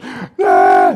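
a sudden loud cry of exasperation, ah!; frustrated shout. Retold or imitated. Preacher acting out his exasperation at a student: loud exasperated ah. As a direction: blurt out an exasperated yell. Frustrated outburst in retold argument.

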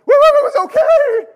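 very high, loud squeal; hammed-up panicked relief. Shaken rider answering the Lord, hammed-up loud squeal. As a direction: blurt it out in mock hysteria.

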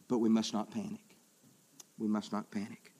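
low, soft, measured delivery; subdued self-restraint. Real, not acted. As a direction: drop it right down and steady yourself. Deadpan reassurance after acted panic.

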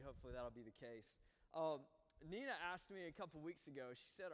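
ordinary talk

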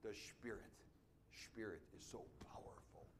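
put-on whispering the line with a German accent; soft German-accented whisper. An elderly church member recalling her faith, whispered in a German accent. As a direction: hush it like the old German woman. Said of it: "with a German accent".

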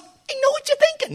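a hint of Paul teasing the church. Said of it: "Paul says".

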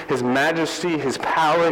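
ordinary talk

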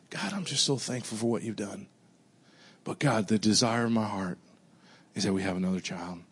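soft prayer. Retold or imitated. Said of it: "this time I prayed a little differently".

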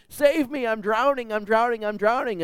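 high, loud, frantic cries for help; drowning man crying out. Retold or imitated. Drowning man crying out to God, frantic and high. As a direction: cry out like a man going under. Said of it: "he's crying out to God".

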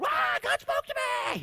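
mock ecstatic cry, pitched very high; excited shout. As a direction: shout it like it just hit you. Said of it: "And God gives you a word".

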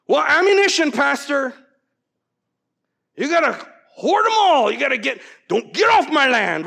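high, loud, worked-up objection; hammed-up indignant protest; protesting congregant. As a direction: imitate a fired-up gun owner.

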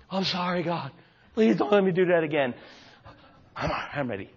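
a hint of a contrite whisper-prayer; guilty prayer. Young believer apologises to God after swearing, softly. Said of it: "And I'd get all guilt".

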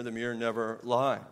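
ordinary talk, nothing marked

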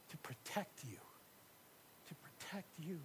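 soft fast whisper. Shown as a demonstration. Voicing God gathering his children, tender whisper. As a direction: hush it as if sheltering chicks. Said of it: "Like this".

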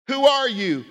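high, loud "Who are you?" with "are" punched; pressing question. Shown as a demonstration. Preacher repeatedly pressing the congregation: who are you. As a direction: demand it with "are" stressed. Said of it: "If I were to say".

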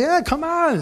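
high-pitched excited welcome; eager invitation. Retold or imitated. Pastor invites the stranger over, eager and excited. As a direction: wave someone in eagerly.

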